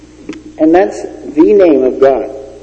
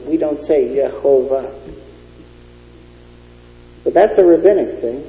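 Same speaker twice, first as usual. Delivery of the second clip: usual speaking voice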